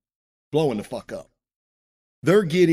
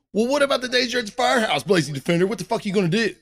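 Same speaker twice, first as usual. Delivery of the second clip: mocking firefighter viewers; 7 semitones higher, faster than usual. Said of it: "and you're going".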